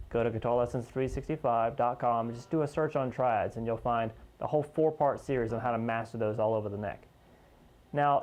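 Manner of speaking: normal talking voice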